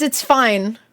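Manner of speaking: ordinary delivery, nothing marked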